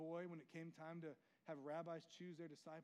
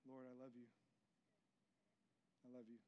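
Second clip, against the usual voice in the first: soft confession; 4 semitones lower, 7 dB quieter than usual. Retold or imitated. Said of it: "But yeah".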